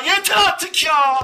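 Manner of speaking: ordinary talk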